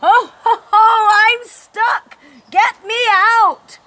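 loud, high, slow stuck cry; Santa shouting. A narrator's reading. Santa stuck in the chimney shouting for help, loud. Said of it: "then turns to a shout".

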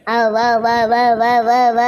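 eerie chant of come, come, come; ghostly beckoning. Pretend. Possessed daughter-in-law calling her mother-in-law closer, eerie chant. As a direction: lure her in like a spirit. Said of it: "உன் கைய கடிக்கிறேன் பாரு".

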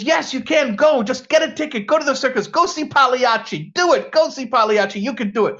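high, loud, emphatic pep talk; mounting insistence. Acted. Doctor pushing his patient to see the clown, excitedly insistent. Joke doctor urging harder, escalating.

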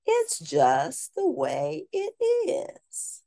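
put-on a little boy explaining the rule; high child voice. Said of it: "said Buddy".

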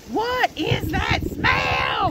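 slow shouted question; loud exclaim. Retold or imitated. Kids reacting to bad smell.

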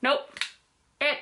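muffled behind cloth mask; speaking with covered mouth; through a face mask. Real, not acted. Seen 1: Blue fabric mask covers mouth throughout; removed by 11.5; refs unmasked.

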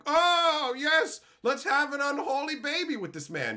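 high, loud swooning mock voice; a fan gushing over the rocker, high and breathless. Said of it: "and you're like".

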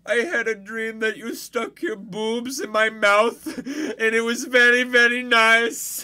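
put-on loud, high caricature Indian accent; hammed-up Indian-accented patient pouring out flattery; squeaky, over-the-top Indian voice. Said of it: "what about Indian Sopranos?".

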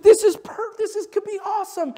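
high, loud thrilled gush; imitating an excited pastor. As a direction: burst with delight. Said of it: "he was just like".

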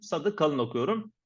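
ordinary talk, nothing marked

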